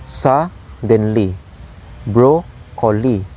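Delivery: "suddenly", "broccoli" slowly split, first syllable stressed; slow "suddenly, broccoli", each falling away after its first syllable; dactylic stress demo. Shown as a demonstration. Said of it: "So it's like high, low, low".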